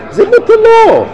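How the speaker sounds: high and loud on the punchline; emphatic exclamation. Real, not acted. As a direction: ring it out like a triumphant reveal. Preacher repeats punchline.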